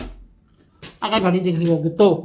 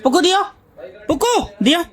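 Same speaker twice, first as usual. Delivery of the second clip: put-on baby talk; 9 semitones higher than usual. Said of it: "देखना इसका reaction".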